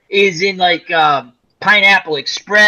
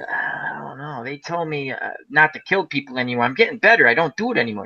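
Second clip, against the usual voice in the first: meek Dom DeLuise; 6 semitones lower, 7 dB quieter than usual. Retold or imitated. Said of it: "Dom DeLuise goes".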